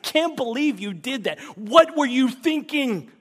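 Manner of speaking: high, loud incredulous scolding; mock exasperation. Preacher imitating people scolding sinners. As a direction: rant at someone who let you down. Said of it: "We say things like".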